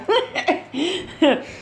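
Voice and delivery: high chuckle; real laugh. Real, not acted. Speaker jokes about not being in jail, laughing. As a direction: laugh at your own misfortune. Laughs at own bad credit.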